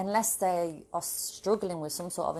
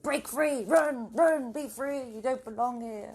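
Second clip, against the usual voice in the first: high, louder hammed-up freedom call; mock rallying cry; 8 semitones higher, 5 dB louder, slower than usual. Vlogger imagining rousing coworkers to quit, higher and louder. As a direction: rouse the office like a cheesy revolutionary.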